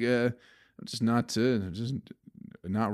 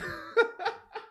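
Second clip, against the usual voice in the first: very high, giggly laugh; 4 dB quieter than usual. Real, not acted. Podcaster cracking up at his own vow, high giggle.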